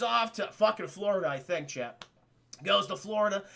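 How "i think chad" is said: usual speaking voice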